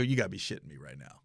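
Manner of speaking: low, soft, quick aside; quiet disbelief. Retold or imitated. Man hearing police are coming, muttering in disbelief. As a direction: sink into hushed retold dismay.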